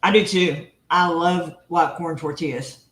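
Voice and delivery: ordinary talk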